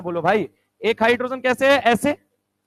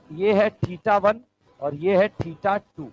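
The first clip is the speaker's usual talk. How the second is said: slow dictation; 4 semitones lower, much slower than usual. Shown as a demonstration. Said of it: "बोलेगा".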